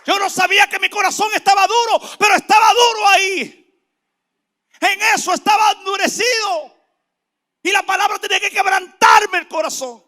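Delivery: high, shouted sermon; preaching at full force. Real, not acted. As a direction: proclaim it at the top of your voice. (prédica a gritos, con toda la fuerza)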